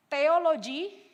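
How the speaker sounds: usual speaking voice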